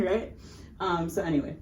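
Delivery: usual speaking voice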